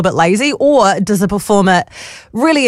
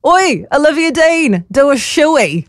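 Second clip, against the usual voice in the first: high, drawn-out heckle without real volume; mock crowd yell; 7 semitones higher, slower than usual. Host imitates someone from the crowd yelling up, mock heckle. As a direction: imitate a fan calling up to the stage. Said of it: "someone from the crowd yells up".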